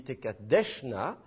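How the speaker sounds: Tekadeshna, lent, appui sur « desh »; détache « Tekadeshna » en accentuant « desh », pas le « na » final; accent sur l'avant-dernière syllabe. Shown as a demonstration. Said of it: "n'est plus la dernière syllabe". (accentuation pénultième de « Tekadeshna »)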